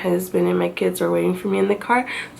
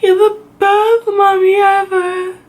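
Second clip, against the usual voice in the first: put-on higher, tearful child reassuring mom; comforting daughter; 9 semitones higher, 3 dB louder than usual. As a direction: soothe mommy like a weeping little girl. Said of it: "She's like".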